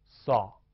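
扫 as one isolated falling syllable; first-half syllable. Shown as a demonstration. Teacher reads the word's first half, 扫 said alone. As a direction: say 扫 on its own, dropping. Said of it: "前半部分我们读作".

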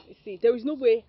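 loud reassurance. Acted. Reassures her brother-in-law.

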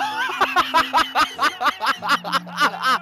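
cackling staccato laughter; helpless big laugh. Real, not acted. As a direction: burst into a long guffaw. Laughing after a goal.

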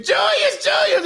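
high shrieked name; screaming fans. Retold or imitated. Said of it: "they were screaming".

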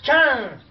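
loud, high comic sound effect; triumphant punchline; punchy "Tchan!". Acted. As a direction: burst out with "Tchan!".

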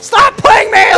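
real frustrated yell at a teammate; anguished shout. Real, not acted. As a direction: wail it in mock despair. Despairing at teammate's play.

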